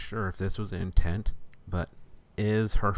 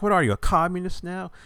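mock accusing; 11 semitones higher, 5 dB louder than usual. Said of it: "she's like".

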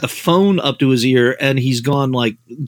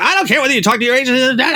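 much higher, fast dismissive snap; high-pitched impatient mentor impression, fast; Harlan cutting him off; 9 semitones higher, faster than usual. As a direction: brush the excuse aside in a rush. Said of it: "He's like".

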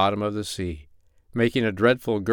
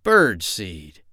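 lightly put-on grumbling voice; muttering pirate; 2 semitones lower than usual. A narrator's reading. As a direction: grumble it to yourself, in character. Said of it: "Ben Ali muttered".

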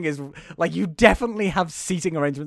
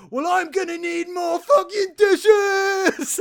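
high, loud and slow mock yell; frantic shout; 12 semitones higher, 4 dB louder, slower than usual. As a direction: demand more dishes in mock panic. Dad frantic in dishwasher race.

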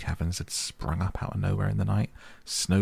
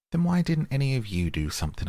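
much higher, exasperated demand; indignant questioning; 8 semitones higher than usual. A narrator's reading. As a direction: scold with a high-pitched question. Otter retells scolding the rabbit.

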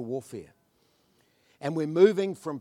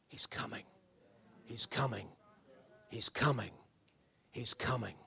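hushed, whispered repetition; softer, breathless whisper of expectation; 7 semitones lower, 12 dB quieter than usual. Acted. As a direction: whisper it like a secret sign. World whispering that he's coming.

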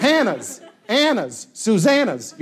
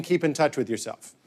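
lower, softer deadpan quote; dad's sign-off, understated; 8 semitones lower, 7 dB quieter than usual. Retold or imitated. Son reporting dad's phone sign-off, understated and quiet. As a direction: drop down and report it drily. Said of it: "he ends every phone call by saying".

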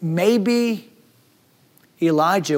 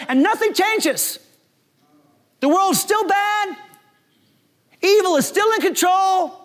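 raised higher and slowed in anguish; despairing Elijah; 9 semitones higher than usual. Acted. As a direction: plead like a man who has given up. Elijah despairing, asking to die.

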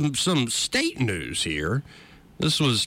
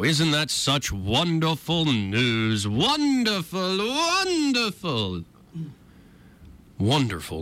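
sarcastic; 6 semitones higher, much slower than usual. Acted. Sarcastic mock delight at news.

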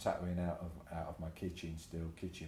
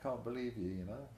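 mocking American tattooists; 8 semitones higher than usual. Tattooists mocking his dressing gown, high jeering. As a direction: rib him like a jeering mate. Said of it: "So they're all just like".

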